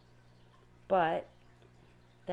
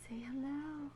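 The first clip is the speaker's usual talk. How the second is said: soft, higher cooing hello to the fish; cooing to pets; 6 semitones higher, 8 dB quieter than usual. Real, not acted. Owner softly cooing hello to her new seahorses. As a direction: coax the seahorses gently, voice lifted.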